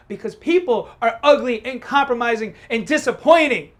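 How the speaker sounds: loud shout building to a peak; angry tirade. Acted. Man lectures fiancée on real world, shouting.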